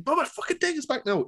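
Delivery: very high Southern voice, barely getting out; high, choked Southern plea; Jim Cornette. Retold or imitated. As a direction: imitate a strained high-pitched Southerner. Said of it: "in that sort of high-pitched Southern voice".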